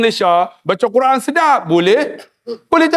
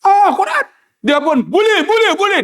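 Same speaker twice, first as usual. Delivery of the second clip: excited realisation; 10 semitones higher than usual. Retold or imitated. Uncle excited, remembers Quran.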